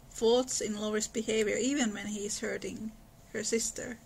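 normal talking voice

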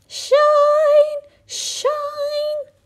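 very high sung shine; shining sun. Acted. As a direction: sing it out brightly like the sun. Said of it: "went the sun".

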